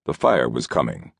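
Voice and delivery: plain reading voice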